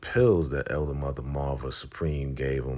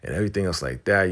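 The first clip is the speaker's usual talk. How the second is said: ordinary talk, nothing marked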